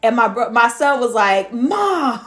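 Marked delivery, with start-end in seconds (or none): 1.5-2.2 put-on higher whiny boy's protest; mock exasperated son; son's voice. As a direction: groan it like an embarrassed kid.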